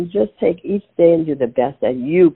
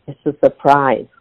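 usual speaking voice

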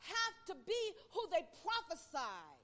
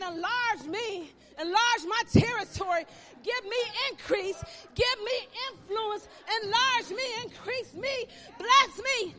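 loud, high imploring prayer; 8 semitones higher, 13 dB louder than usual. Acted. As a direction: cry out the prayer to God. Prayer voiced at full force.

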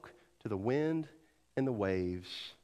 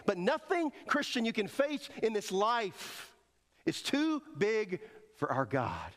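fervent climax; 7 semitones higher than usual. Real, not acted. Preacher declares to the church that nothing is too big for God, high. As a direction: rise to the climax.

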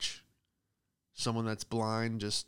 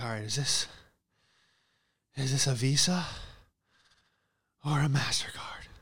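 out of breath; 2 semitones higher than usual. Retold or imitated. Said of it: "like, out of breath".

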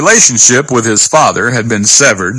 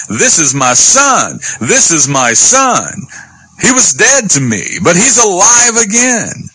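slow proud cry climbing much higher; mock joyful shouting; 6 semitones higher than usual. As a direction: proclaim it as the overjoyed father. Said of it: "his father started shouting".

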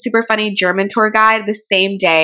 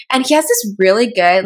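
normal talking voice

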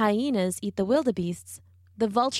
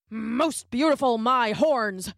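grunting wildebeest; 3 semitones higher, slower than usual. A narrator's reading. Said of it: "grunted a wildebeest".

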